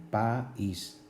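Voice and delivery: "País" split clearly into pa-ís; two separate syllables. Shown as a demonstration. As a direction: pull the a and í of "País" apart. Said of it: "se pronuncian en dos sílabas diferentes".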